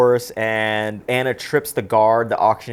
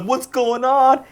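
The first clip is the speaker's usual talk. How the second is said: dazed henchman; 12 semitones higher, slower than usual. Retold or imitated. Needle-faced henchman reappearing, high slow puzzlement. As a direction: play the needle-faced goon, bewildered. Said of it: "he's like".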